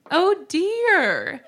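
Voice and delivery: high prim "Oh dear"; mom's prim voice. Retold or imitated. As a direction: voice the proper mom clutching pearls. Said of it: "she wrote".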